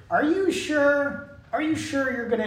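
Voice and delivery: doubtful questioning. Acted. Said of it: "little bit of doubt there".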